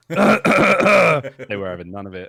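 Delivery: loud, slow, hammed-up voice; mock dramatic quote. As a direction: ham it up as if quoting. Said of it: "I was like".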